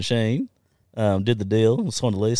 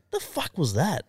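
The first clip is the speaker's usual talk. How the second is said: foreman's shocked question; 10 semitones higher, slower than usual. Retold or imitated. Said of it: "he's like".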